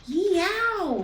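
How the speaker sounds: high, loud yowl; cat screech. A narrator's reading. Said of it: "screeched Beak One".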